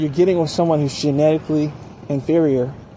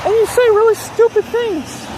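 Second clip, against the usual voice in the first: voice goes high. Shown as a demonstration. Man flustered by a beautiful woman, voice going much higher. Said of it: "your voice goes high".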